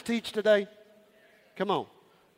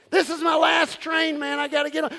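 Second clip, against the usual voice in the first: urgent hammed-up plea, higher; half-comic panicked voice; mock desperate; 11 semitones higher, 6 dB louder than usual. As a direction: rush for the last train. Acted desperate lover quote.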